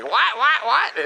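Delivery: mock a flustered old lady not catching the name; baffled old lady. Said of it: "and she's like".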